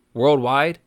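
normal talking voice

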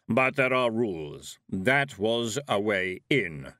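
slow, heavy voice of Death; heavy thundering voice. A narrator's reading. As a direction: let it rumble like distant thunder. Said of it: "said Death".

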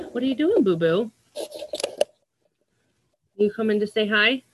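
pet talk. Real, not acted. Talking to her pet.

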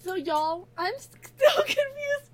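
trying to read through laughter; high, slow, cracking up mid-line; breaking into laughter. Real, not acted. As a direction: lose it while reading the line. Breaks up while reading the line.

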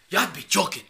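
loud, hammed-up African movie accent. Riffing on African movies to the co-host, loud mock African accent. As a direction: exclaim it like an African movie character, loudly. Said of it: "if you guys haven't seen African movies".

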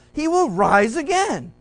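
Jesus triumphantly announcing the resurrection; excited reassurance. Retold or imitated. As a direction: lift them up with the good news.

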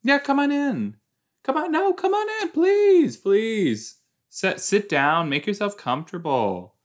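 very high, squeaky over-keen hostess; mock eager welcome. Host mocking someone inviting in the pizza man, gushing. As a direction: gush and plead in a hammed-up high voice. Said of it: "I wouldn't say".

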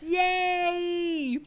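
high, loud delighted yay; excited cheer. Real, not acted. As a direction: cheer the news. Friend's engagement news, cheering.

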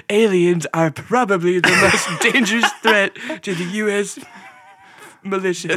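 mock press-conference gravity, cracking up; Donald Trump. President warning about aliens, hammed-up and laughing. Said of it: "a Trump Reagan accent".

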